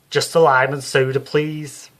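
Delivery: loud, high, brassy Liverpool medium; Psychic Sue voice. Acted. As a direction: play her as a brash Scouse showwoman. Psychic Sue orders at the pub.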